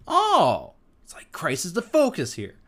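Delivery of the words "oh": high, loud "oh" of awe; excited outburst. Real, not acted. Preacher overwhelmed by the verse he just read, excited outburst. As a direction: burst out, overcome by what you read.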